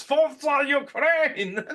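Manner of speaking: very high, very slow battle cry; hammed-up mock shout. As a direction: stretch it out like a war cry. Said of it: "just shouts".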